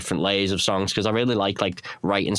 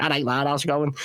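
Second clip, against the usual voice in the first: modern Liverpool Scouse, pitched higher; modern Scouse accent; 5 semitones higher than usual. Shown as a demonstration. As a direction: greet him like a young modern Scouser. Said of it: "when you listen to a modern, like, Scouse".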